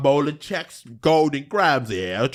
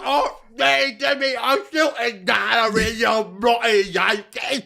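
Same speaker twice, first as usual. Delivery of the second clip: high, slow shouted British huff; mock British outrage; 7 semitones higher, slower than usual. Teasing the British-American feud, high British shout. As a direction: shout it high like an offended Brit.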